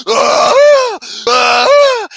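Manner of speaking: very high, loud drawn-out wails; exaggerated imitated moans; mock orgasmic wailing. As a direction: wail mockingly, hammed right up. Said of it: "Mocking her with the orgasmic".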